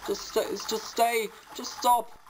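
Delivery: high, wailing angry pleas; desperate furious begging; crying with rage. Real, not acted. Raging at enemy.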